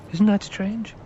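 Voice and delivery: put-on a boy baffled by his own dream; puzzled boy.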